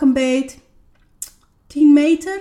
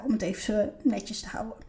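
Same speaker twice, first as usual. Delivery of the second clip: soft whispered aside; 3 semitones lower, 10 dB quieter than usual. Real, not acted. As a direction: whisper it discreetly. Discreet aside about affairs. (gefluisterd terzijde)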